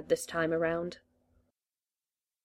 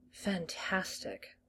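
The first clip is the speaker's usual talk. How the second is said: slow, much softer sigh; 9 dB quieter, much slower than usual. A narrator's reading. The doctor wistful about Shakespeare, sighing. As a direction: let it out on a sigh. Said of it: "the doctor sighed".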